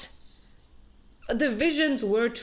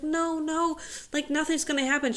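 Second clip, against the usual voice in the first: higher, fast, hammed-up reassurance; mock soothing Oro; 6 semitones higher than usual. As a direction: rush the comfort, pitched up. Oro calming Isla in retelling.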